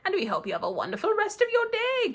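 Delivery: normal talking voice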